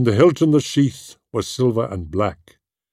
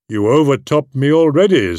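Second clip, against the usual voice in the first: raised, louder, delighted; fond praise; 5 semitones higher, 5 dB louder than usual. Acted. Húrin lifting Túrin onto the table, fondly proud. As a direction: beam with pride at your son.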